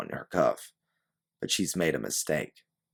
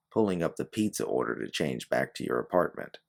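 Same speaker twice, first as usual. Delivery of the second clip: ordinary delivery, nothing marked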